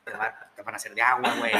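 low, soft fatherly warning; stern dad warning. Retold or imitated. Father warning his son about Monterrey, low quiet caution.

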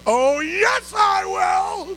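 high, loud whoop of belief; excited shout. Retold or imitated. Storyteller answering the realtor's big news, excited shout.

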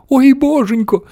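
put-on high old-lady lament; storybook cry of dismay; wailing. A narrator's reading. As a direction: wail it way up high. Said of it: "заголосила бабуня Джорджина".

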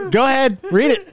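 usual speaking voice

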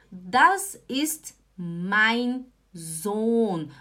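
very slow, "Sohn" with a long o; long vowel. Shown as a demonstration. Teacher introducing the H rule, drawn-out vowel. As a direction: draw out each word, holding the o in "Sohn". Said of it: "ela é longa".

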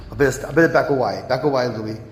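quick, half-joking retreat; self-check. Real, not acted. Preacher reining himself in, fast and self-mocking. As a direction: talk yourself down quickly. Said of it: "I'm getting a bit raw".

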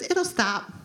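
put-on high little toddler voice; granddaughter voice. Grandfather imitating his toddler granddaughter. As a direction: squeak it like a three-year-old. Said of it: "She goes".

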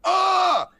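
sudden high yelp of pain; sleeping man yelping when stepped on. Retold or imitated. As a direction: yelp as someone steps on your chest. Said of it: "the guy's like".

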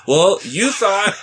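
high, loud, slow mock taunt; sing-song teasing of a dumb dude. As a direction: tease him like a big kid, drawn out. Teasing a friend for mistake.

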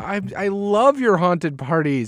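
high, slow whining; whiny. Real, not acted. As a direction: moan about missing the party. Bummed about missing party.

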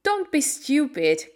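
high, slow, scornful rebuke; exasperated cry. A narrator's reading. Mary scolding crying Colin, exasperated cry. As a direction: scold him, stretching it out. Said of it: "cried Mary".

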